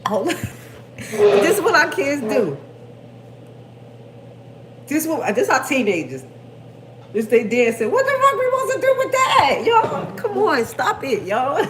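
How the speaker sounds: loud laughing disbelief. Real, not acted. Laughing at teens' dance video.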